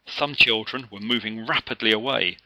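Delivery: usual speaking voice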